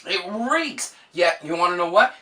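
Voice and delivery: usual speaking voice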